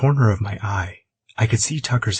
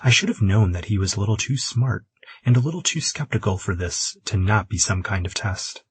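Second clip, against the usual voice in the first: plain reading voice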